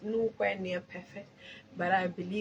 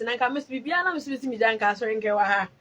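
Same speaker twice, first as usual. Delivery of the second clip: ordinary talk, nothing marked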